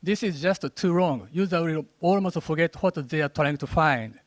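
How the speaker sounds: ordinary delivery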